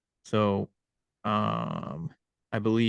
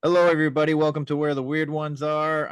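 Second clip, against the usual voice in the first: podcast host intro; 5 semitones higher, 4 dB louder, faster than usual. Acted.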